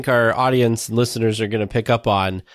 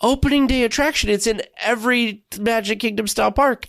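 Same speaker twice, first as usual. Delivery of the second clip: incredulous; 10 semitones higher than usual. Retold or imitated. Disbelieving retelling of own reaction.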